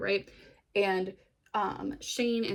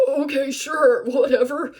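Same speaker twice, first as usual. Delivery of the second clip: loud, higher-pitched brush-off; mock dismissive; 6 semitones higher, 11 dB louder than usual. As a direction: play a baffled paramedic humoring him loudly. Paramedics' baffled reply, acted.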